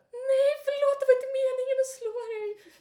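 loud, very high squealed apology; guilty pleading. Retold or imitated. Woman apologising to the man she hit, high guilty wail. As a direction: wail an over-the-top sorry. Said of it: "mådde jättedåligt".

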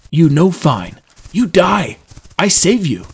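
loud, stern, emphatic short phrases. A narrator's reading. Trendon overruling Drew's refusal of help, stern. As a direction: lay down the law loudly. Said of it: "Trendon replied sternly".

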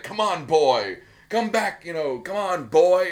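loud, high mock battle cry of grief; Strax shouting. Strax yelling at Jenny to come back, in a loud mock bellow. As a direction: bellow it like a clumsy soldier reviving a comrade.

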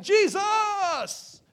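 high, loud drawn-out cheer; yelled acclaim. Acted. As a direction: holler the name to the crowd. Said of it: "they were yelling".

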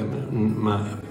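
normal talking voice

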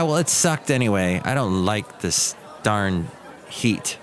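ordinary talk

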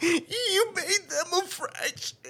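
high, slow, breaking into real laughter; laughing hard. Real, not acted. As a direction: squeeze the words out through laughter. Cracking up at friend's remark.

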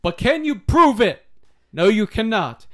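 hammed-up triumphant challenge; loud mock challenge. Mock triumphant gotcha in banter.

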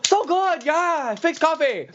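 high mock Chinese-accented English. Mandarin-speaking customer orders decaf, in a high mock Chinese accent.

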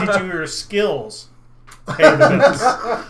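slow, loud, laughing punchline; slow drawn-out. Acted. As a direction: stretch out every word with a laugh. Repeats question drawn out, laughing.

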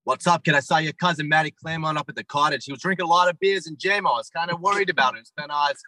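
hammed-up Boston accent; thick Boston townie talk, Rs dropped; worst Boston accent. Shown as a demonstration. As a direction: lay on a broad Boston accent. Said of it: "give me your worst Boston accent".

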